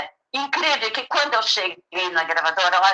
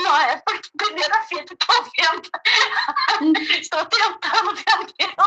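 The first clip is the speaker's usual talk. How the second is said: laughing; 7 semitones higher than usual. Retold or imitated. Laughing while quoting herself.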